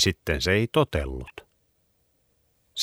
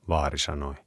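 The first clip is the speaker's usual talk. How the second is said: ordinary delivery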